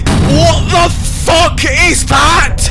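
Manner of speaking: drawn-out, high startled yell; startled shout. Real, not acted. Gamer spots a creature in the dark, startled slow yell. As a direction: shout it out as the thing appears. Scared by something in the game.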